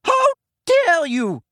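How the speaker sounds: very high, loud furious cry; furious shout. A narrator's reading. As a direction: scream it out in outrage. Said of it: "the figure shouted as she ran".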